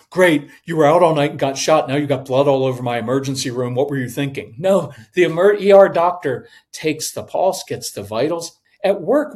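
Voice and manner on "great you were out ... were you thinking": loud, exasperated mock scolding; freaking out. Emergency doctor berating a shot patient, freaking out. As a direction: burst in loud, then rattle off the blame. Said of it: "doesn't freak out".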